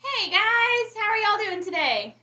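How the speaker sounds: bright, high welcome to viewers; excited greeting. Real, not acted. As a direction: greet them warmly, excited. Greeting viewers who just joined.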